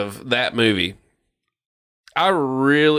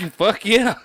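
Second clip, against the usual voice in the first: laughing; 9 semitones higher than usual. Real, not acted. Laughing exclamation, high.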